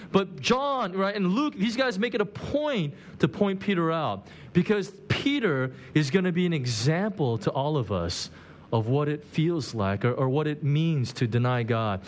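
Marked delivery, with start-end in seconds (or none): none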